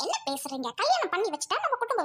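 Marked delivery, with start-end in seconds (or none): 0.1-0.7 low, soft, taken-aback question; wife hit by her husband's accusation; stunned quiet. Acted. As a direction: let it drop out in disbelief.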